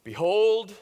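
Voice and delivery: Behold rings out loud and lifted, a proclamation; proclaiming behold. Acted. Preacher voicing John announcing Jesus, a loud proclamation. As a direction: throw Behold out to the whole crowd. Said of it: "And he declares".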